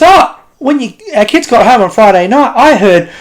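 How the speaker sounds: a hint of a chatty parent reporting; parent quote. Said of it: "and he goes".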